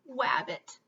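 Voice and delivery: "Wabbit" with W for R, mumbled; sheepish "Wabbit" with W. Acted. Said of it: "he mumbled".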